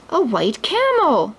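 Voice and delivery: drawn-out surprised exclaim, pitched high. A narrator's reading. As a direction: marvel at the gift. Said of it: "Aladdin exclaimed".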